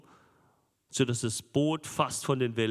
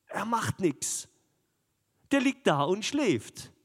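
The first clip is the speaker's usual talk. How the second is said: higher, slower, indignant: "Er macht nichts"; raised indignant telling; 7 semitones higher than usual. Acted. Preacher to congregation, indignant and raised. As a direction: lift it up in disbelief. Dramatic contrast on sleeping Jesus.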